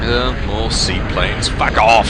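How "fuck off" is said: angry shout. Real, not acted. Enemy seaplanes arrive.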